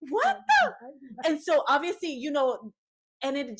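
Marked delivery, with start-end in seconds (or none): 0.1-1.3 shocked. Retold or imitated. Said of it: "And I was like".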